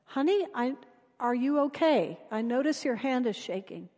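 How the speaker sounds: soft, caring question to a stranger; soft reassuring voice of a worried friend; gentle concern. Retold or imitated. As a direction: reach out kindly, quietly. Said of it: "puts aside her first impression and says".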